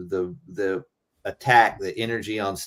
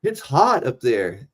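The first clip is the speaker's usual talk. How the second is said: higher, louder complaint; complaining; 6 semitones higher, 5 dB louder than usual. Retold or imitated. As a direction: whine about the heat, loudly. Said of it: "I remember one time going".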